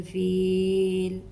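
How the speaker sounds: drawn-out recited फील with a long vowel; Quranic recitation. Shown as a demonstration. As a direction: chant फील slowly and stretch the ई. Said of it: "अक्सर लोग पढ़ देते हैं".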